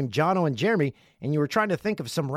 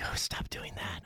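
softer, whispered "stop doing that"; whispered quote; 8 semitones lower, 11 dB quieter than usual. Retold or imitated. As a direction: hush it like she's embarrassed. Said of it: "She's like".

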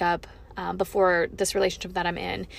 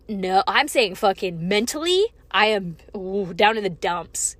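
ordinary talk

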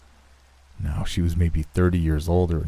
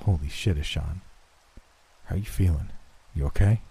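softer, stunned concern; hushed shock; 4 dB quieter than usual. A narrator's reading. Boss at the hospital bedside finding him awake, hushed mild shock. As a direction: check on him quietly, a little taken aback.

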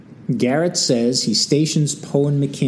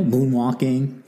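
usual speaking voice

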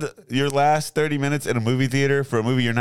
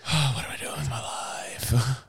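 despairing whisper; 6 dB quieter than usual. Acted.

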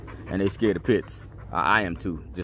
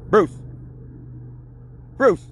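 calling the dog; 10 semitones higher than usual. Real, not acted. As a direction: yell the dog's name to stop him. Shouting at his dog outside.

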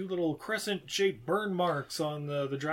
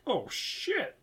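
high dismayed gasp of a curse; 9 semitones higher, 3 dB quieter than usual. Retold or imitated. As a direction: let it slip, stunned. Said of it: "I'm like".

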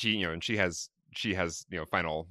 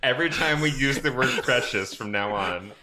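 laughing; 6 semitones higher, 6 dB louder than usual. Real, not acted. Group cracking up at joke.

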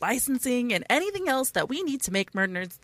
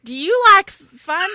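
slow, loud Southern twang; Southern accent; 8 semitones higher, 7 dB louder, slower than usual. Pretend. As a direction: drawl it Southern. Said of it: "You went Southern again".

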